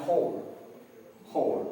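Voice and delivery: "whole" con h aspirada y r final, como "hor"; pronunciación modelo. Shown as a demonstration. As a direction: di "whole" como "jor", w muda. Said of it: "que se pronuncia whole". (pronunciar "whole" sin w, terminado en r)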